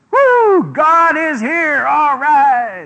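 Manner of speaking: high, loud whoops of joy, stretched out slowly; whooping cheer. Retold or imitated. The Israelites greeting the ark in camp, a loud high whooping cheer. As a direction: cheer like a crowd whose God just showed up.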